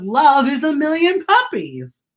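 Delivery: put-on high, eager little child voice; an excited kid on the swings; child's voice. A narrator's reading. Teacher reading to class, voicing an excited child. Child's line in read-aloud.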